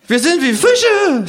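sehr hohe, laute, gedehnte Figurenstimme; Känguru. Acted. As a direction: langsam und hoch verkünden. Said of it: "sagt das Känguru". (hohe, gedehnte Känguru-Stimme)

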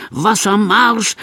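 slow called command. A narrator's reading. Fire chief ordering his men, slow command. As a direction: bark the order. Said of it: "Das Kommando kommt".

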